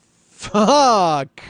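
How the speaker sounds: high, loud, long-drawn curse; frustrated yell. Retold or imitated. Customer hearing the repair takes ten days, frustrated drawn-out yell. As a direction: let one word out as a long, exasperated howl.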